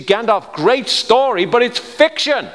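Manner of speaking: high, loud, drawn-out insistence; emphatic exclamation. Real, not acted. Preacher telling congregation Gandalf is fiction, emphatic exclamation. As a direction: cry it out to drive the point home. Preacher drives home his contrast.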